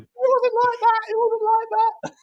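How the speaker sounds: very high, squeaky mock pleading; squeaky protest. Host jokily defending his accents, in a squeaky voice. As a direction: protest in a tiny high-pitched voice.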